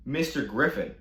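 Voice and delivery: normal talking voice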